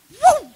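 high, loud "woo" of someone tearing off; sudden high whoop; whooshing woo. Retold or imitated. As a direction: whoop like a runner zooming away. Imitates man speeding away.

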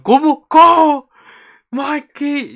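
shrieking disbelief; shocked shout. Retold or imitated. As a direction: scream with fan shock.

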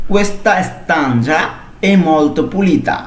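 very slow, every syllable of Questa stanza laid out for learners; teacher reads the Italian sentence slowly for students to copy; slow model reading. Shown as a demonstration. Teacher to Bengali learners, slow clear demo reading. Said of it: "পুরোটা আমরা একসাথে যদি পড়ি".

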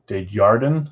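Desjardins as a questioning "day-JAR-den"; hard "jar" pronunciation. Shown as a demonstration. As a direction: ask Desjardins with "jar" in the middle. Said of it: "you don't say jar, do you?".

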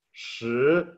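"shi" with a rising Chinese tone; Mandarin shí. Shown as a demonstration. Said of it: "se pronuncia shi".

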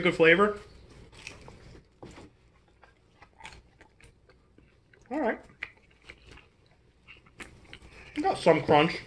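talking while chewing; speaking between bites; mouth full of pizza. Real, not acted. Seen 0: Man chews and bites pizza slice on camera while speaking.

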